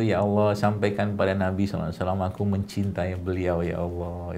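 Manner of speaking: soft, hushed and tender, as if praying alone; calm heartfelt prayer. Shown as a demonstration. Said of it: "lagi sendiri, lagi tenang gitu".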